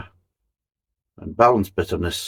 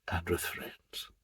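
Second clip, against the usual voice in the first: whispered aside; 2 semitones lower, 16 dB quieter, slower than usual. Real, not acted. Jokey aside about drinking with friends.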